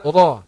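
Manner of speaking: "Ra" dengan ujung lidah ringan; a light tongue-tip "Ra"; light ra demo. Shown as a demonstration. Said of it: "huruf ra juga begitu". ("Ra" ringan)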